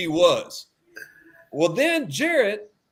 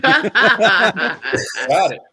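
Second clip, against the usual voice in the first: laughing "got it"; 7 dB louder than usual. Retold or imitated. Laughing at own reaction.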